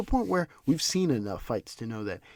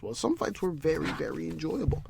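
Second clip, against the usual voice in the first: ordinary delivery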